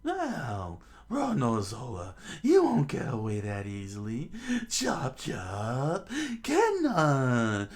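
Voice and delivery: high, loud villain battle cry; hammed-up clown villain roar; Buggy attacking. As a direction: bellow the attack name, ending in a cackle. Buggy launches attack in story.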